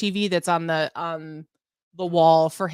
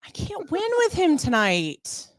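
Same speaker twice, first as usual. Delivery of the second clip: exasperated; 10 semitones higher, slower than usual. Real, not acted. Exasperated at teasing husband.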